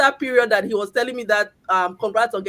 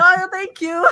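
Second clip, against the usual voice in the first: mock polite thanks; 7 semitones higher, 5 dB louder, slower than usual. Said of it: "But I just have to".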